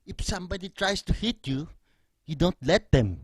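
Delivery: loud, exasperated Filipino dad; mock angry dad lecture; dad's scolding. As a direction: scold like a fed-up father. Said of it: "he was like".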